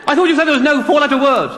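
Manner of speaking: hammed-up accusing outburst; mock outrage. DJ confronting guest over four-letter words, retold outrage.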